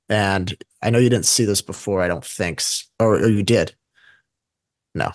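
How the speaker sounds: ordinary delivery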